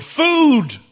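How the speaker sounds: huge high bellow. Acted. King bellowing the gifts to the peasant, full loud roar. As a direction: roar it out with joy. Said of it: "he bellowed".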